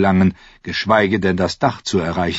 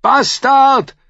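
high, loud jeer; roaring; 6 dB louder than usual. A narrator's reading. Someone in the mob to the king, roaring. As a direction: bellow the insult at the king. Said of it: "brüllte jemand Joffrey zu".

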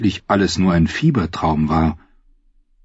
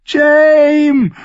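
very high, loud terrified scream; 7 dB louder than usual. A narrator's reading. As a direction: scream his name in agony. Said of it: "hörte sie sich schreien".

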